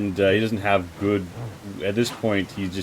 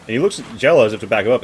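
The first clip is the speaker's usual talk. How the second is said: ordinary talk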